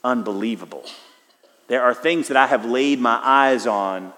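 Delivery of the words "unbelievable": hushed, low wonder at the Astrodome; quiet awe. Retold or imitated. Preacher recalling the Astrodome, quiet hushed awe. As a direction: breathe it out in awe, softer and lower. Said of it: "and thought".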